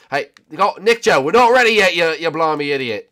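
loud, high telling-off; exasperated scolding. Real, not acted. Player telling Nick Joe they're not ready yet, exasperated scolding. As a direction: yell at a player jumping the gun.

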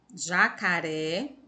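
"Jacaré" said slowly, leaning on the final "ré"; stressed final syllable. Shown as a demonstration. Teacher shows pupils the stressed syllable, "Jacaré" with a drawn-out "ré". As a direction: stretch "Jacaré" so the last syllable stands out. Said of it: "essa sílaba fica mais forte".

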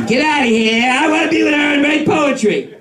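high, wailing mock protest; hammed-up outburst. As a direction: cry out like a thwarted poet. Acted outburst of would-be poet.

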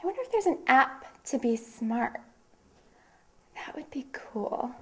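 soft, trailing into a near whisper; quiet musing aside. Acted. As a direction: muse under your breath, as if to yourself. Musing to herself between news items.

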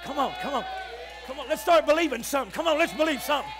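preacher shouting "come on" to the crowd; rousing exhortation. Acted. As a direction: fire the congregation up.

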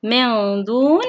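slow من دون, noon held in the nose; ikhfa demonstration. Shown as a demonstration. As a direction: stretch the hum between من and دون. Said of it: "هو حرف الدال". (إخفاء النون بغنّة ممدودة في من دون)

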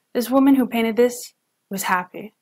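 usual speaking voice

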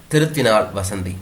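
usual speaking voice

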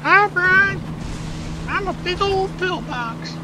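very high cartoon voice for a talking pillbox; silly pillbox voice. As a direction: give the pillbox a squeaky goofy voice. Voicing the pillbox greeting him.

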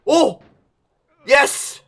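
high, sudden cheer; relieved excitement. Real, not acted. Gamer finally making the rock, excited cheer. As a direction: burst out as it finally lands.

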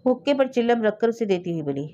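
ordinary delivery

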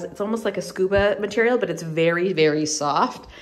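ordinary delivery

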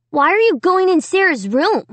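put-on high, nosy kid's challenge; demanding. A narrator's reading. Little brother questioning sister outside the room, demanding. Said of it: "he demanded".

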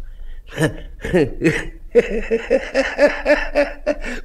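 long, high, far-carrying laugh; a sour, drawn-out laugh; bitter laugh. Acted. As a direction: laugh it off with a sting. Drunk painter laughs at refusal.

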